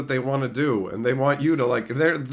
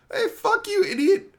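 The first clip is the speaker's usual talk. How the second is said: mock taunt.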